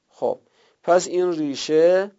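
ordinary talk, nothing marked

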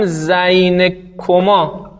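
زین کما said very slowly, komɑ stretched; drawn-out زین کما with a falling end; slow model form. Shown as a demonstration.

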